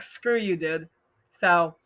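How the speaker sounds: ordinary talk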